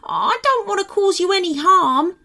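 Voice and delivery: pleading. A narrator's reading. Timmy calming two frightened gnomes, pleading. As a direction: anxiously reassure them in a high voice. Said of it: "pleaded Timmy".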